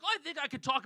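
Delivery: put-on older man. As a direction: imitate an older man, starting high and loud. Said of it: "late fifties, early sixties".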